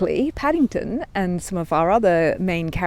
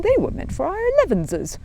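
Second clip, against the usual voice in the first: much higher small bear voice; Paddington's voice; 13 semitones higher, 4 dB louder than usual. A narrator's reading. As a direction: speak as the little bear, dismayed.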